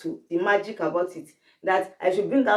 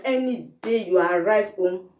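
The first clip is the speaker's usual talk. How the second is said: ordinary delivery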